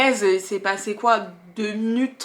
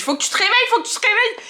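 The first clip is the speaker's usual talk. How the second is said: urgent plea; 12 semitones higher, much faster than usual. Retold or imitated. Slapping her awake.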